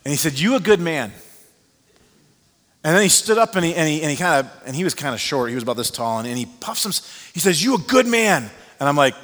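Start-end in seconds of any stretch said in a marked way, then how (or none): 0.4-1.1 higher, louder, firm; emphatic declaration. Retold or imitated. [name] repeating his praise, firm and louder. 7.6-8.5 much higher, much louder, proud; emphatic declaration. Retold or imitated. [name] telling the pastor he's good, loud and emphatic.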